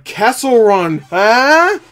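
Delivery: loud, high, hammed-up name-drop with a big questioning huh; exaggerated, in-your-face plug ending on a soaring huh; mock forced reference. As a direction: nudge the audience way too hard. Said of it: "didn't feel like it was forced".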